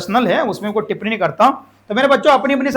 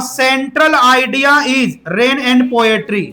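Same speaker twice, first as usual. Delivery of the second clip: very slow, word by word; slow dictation; much slower than usual. Shown as a demonstration. Teacher dictating the central idea to the class, very slowly. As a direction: spell it out for the notebooks.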